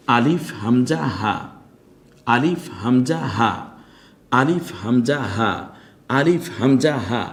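আলিফ হামজা হা, each sound clear from the throat; drilled throat letters. Shown as a demonstration. Teacher drilling throat letters for students to repeat. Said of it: "ভালো করে দেখার চেষ্টা করি".

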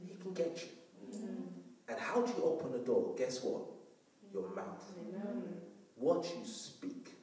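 ominously soft-spoken; hushed warning. Real, not acted. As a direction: drop to a soft, near-whispered warning.

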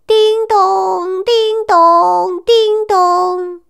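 high sing-song ding-dong; imitated doorbell chime. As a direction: ring it like a doorbell. Doorbell ringing in story. (模仿门铃声)

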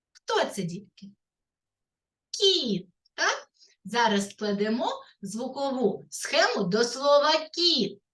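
ordinary talk, nothing marked

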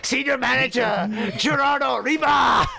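mock-grand Japanese-accented roll call; horrible Japanese accent. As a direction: announce each name, stretched, in a fake Japanese accent. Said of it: "doing a horrible Japanese accent".

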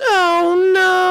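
ordinary talk

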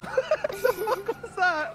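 high, slow squeal of disbelief; shocked squeal. Real, not acted. Friend squeals in shock at his buddy's odd throat-clearing. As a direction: squeak it out in stunned surprise.

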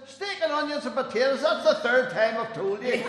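loud, high, fed-up punchline; exasperated shout. Acted. Wife answering her husband for the third time, exasperated shout. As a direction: yell it like you're sick of repeating yourself.

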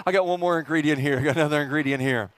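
ordinary talk, nothing marked

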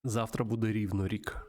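usual speaking voice

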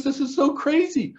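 very high put-on weepy voice; exaggerated tearful imitation; mock crying. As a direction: whine it as if in tears. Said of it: "And she's now crying".

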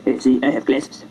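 lightly voiced low cool dude; cool guy. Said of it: "the cool guy with the glasses".

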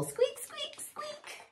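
tiny high squeaky mouse; mouse squeak. Acted.

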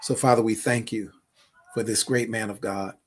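intimate closing prayer. Acted. Closing prayer over the couple.

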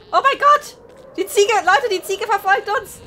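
loud, high panicked yelling; panicked shouting. Real, not acted. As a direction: yell in alarm at the goat. Goat chasing them in game.

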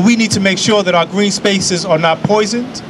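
ordinary talk

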